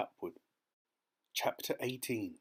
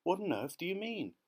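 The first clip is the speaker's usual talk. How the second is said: much higher, baffled demand; astonished cry; 9 semitones higher than usual. A narrator's reading. Insects questioning the ladybird, astonished. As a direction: demand an explanation in disbelief. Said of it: "they cried".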